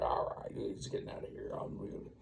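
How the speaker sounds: hushed, sneaking away; soft whispered retreat. Retold or imitated. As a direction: whisper it as he slinks off. Backing off quietly.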